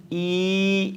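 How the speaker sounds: ای held long on one even note; long vowel. Shown as a demonstration. Teacher models vowel sounds for learners, long drawn ee. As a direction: stretch ای into a long steady ee.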